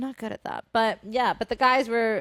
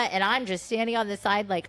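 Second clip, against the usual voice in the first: usual speaking voice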